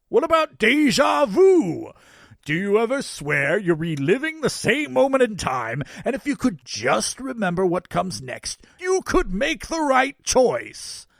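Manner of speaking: high, loud, emphatic Santa holler; shouting over shoulder. A narrator's reading. Santa pulling away in the chase, hollering back. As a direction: holler it back from far ahead. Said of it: "shouting over his shoulder".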